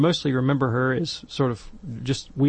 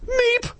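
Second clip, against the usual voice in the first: a much higher squeak like a startled mouse; terrified child squeaks; tiny frightened meep. Retold or imitated. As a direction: squeak it out, caught in the headlights. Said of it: "like a deer in the headlights".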